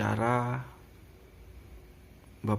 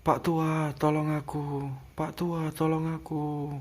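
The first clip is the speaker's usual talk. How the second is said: higher, desperate plea for help; pleading; 5 semitones higher than usual. Retold or imitated. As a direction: beg the old man to save you. Begging the old man for help. (memohon pada Pak Tua, nada tinggi)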